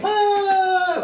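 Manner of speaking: high cheering crowd cry; mock crowd cheer. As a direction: cheer like a studio audience. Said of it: "like an audience".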